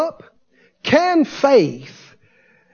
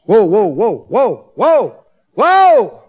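mounting astonishment; much slower than usual. Acted. Preacher halting at the verse, mounting astonishment. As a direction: stop everyone short, building each whoa.

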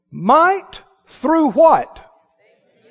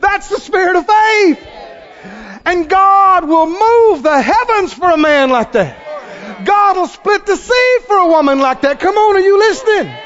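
preaching at full force; 6 semitones higher than usual. Real, not acted. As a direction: fire up the congregation.

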